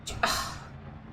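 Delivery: long, soft sigh; weary sigh. Real, not acted. Gamer fed up with a poor result, weary sigh. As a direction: let out a quiet, breathy sigh.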